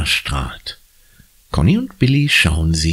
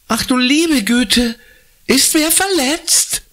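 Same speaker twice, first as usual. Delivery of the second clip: shrieking. Acted. Woman at the window shrieks in alarm. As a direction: cry out in fright. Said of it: "kreischt jemand".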